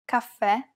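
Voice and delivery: "caffè" ending in a stressed open e; careful teaching "caffè" with open, stressed last vowel. Shown as a demonstration. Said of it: "pronounce it as an open E".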